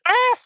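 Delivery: sudden high yelp of surprise at a spray of water; startled yelp. Real, not acted. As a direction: jump at a cold splash with a sharp cry. Sprinkler sprays him.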